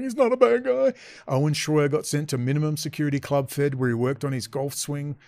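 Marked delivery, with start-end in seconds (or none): 0.0-0.9 mocking Clay Clark. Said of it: "Clay Clark's going".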